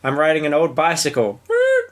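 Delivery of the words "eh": tiny falsetto eh; high squeak. Pretend. Squeak and grunt routine.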